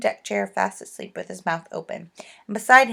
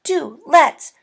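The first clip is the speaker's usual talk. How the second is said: eager pleading; 4 semitones higher, 8 dB louder than usual. Acted. Bessie begs to go.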